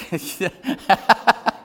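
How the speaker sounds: loud, high burst of laughter; amused laugh. Real, not acted. Pastor laughing over bothering his two girls, amused laugh. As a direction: laugh out loud at your own story.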